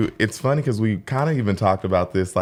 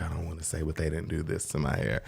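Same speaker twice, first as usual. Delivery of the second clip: soft imitated client; 3 semitones lower, 12 dB quieter than usual. Quoting the client's words.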